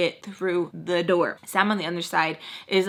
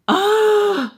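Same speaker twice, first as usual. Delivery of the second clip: high, loud frustrated ugh; frustrated groan; 13 semitones higher, 9 dB louder than usual. Real, not acted.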